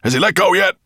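loud high dragon call; booming. A narrator's reading. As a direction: boom it out. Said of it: "boomed Nettlebrand's voice".

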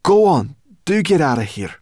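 high, loud, bossy call; impatient shooing. Acted. Sorrel sending the dragon off, loud impatience. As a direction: shout them on their way.

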